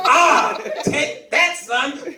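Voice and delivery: high loud gleeful taunting; gloating. Retold or imitated. The kid gloating that his dad is in trouble, shouting. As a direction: rub it in with a shout. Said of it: "I'm like".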